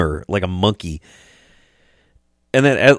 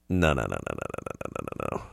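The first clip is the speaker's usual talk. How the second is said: low, soft string of noes fading away; imitated dismissive refusal; 12 dB quieter, faster than usual. Friends correcting him about the market, low dismissive fade. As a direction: rattle it off low, dwindling to almost nothing.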